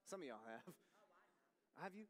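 ordinary talk

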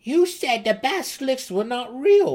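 high, loud squabbling gnome; gnome shouting. A narrator's reading. Young gnome shouts accusingly at his friend. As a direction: shout the accusation like an indignant kid. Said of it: "shouted to another".

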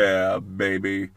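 sultry voice. Pretend. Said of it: "more sultry voice".

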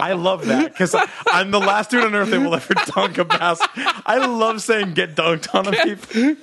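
high-pitched talk through giggles; amused, laughing. Real, not acted. Podcaster enjoying a co-host's echo, laughing. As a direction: laugh as you relish the silly phrase. Delighted laughing over the phrase.

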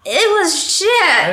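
slow, high, loud put-down; emphatic retort. Real, not acted. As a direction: flatly overrule the friend. Disagreeing with friend's verdict.

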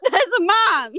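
panicked high yell; shout. Retold or imitated. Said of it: "favourite lines to shout".

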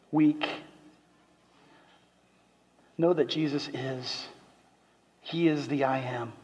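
low, soft, hushed preaching; soft closing appeal. Real, not acted. Preacher softly assuring the congregation, hushed and low. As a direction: draw them in gently, almost murmuring.

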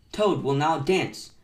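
ordinary delivery, nothing marked

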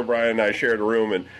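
usual speaking voice

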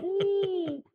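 high, loud mock-awed whoop; impressed "ooh". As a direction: let out a big rising-pitched ooh. Retold reaction to gun boast.

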